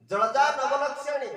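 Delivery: loud, high, slow scripture call; forceful verse recitation. Real, not acted. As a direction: proclaim the verse to the assembly at full voice. Preacher calls out verse forcefully. (ଉଚ୍ଚ ସ୍ୱରରେ ଶ୍ଲୋକ ଘୋଷଣା)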